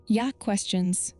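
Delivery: usual speaking voice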